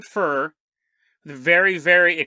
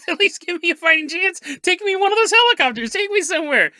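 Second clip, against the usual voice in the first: much higher, loud pleading; pleading soldier; 10 semitones higher, 4 dB louder than usual. Acted. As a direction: beg desperately, high and fast. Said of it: "The guy's like".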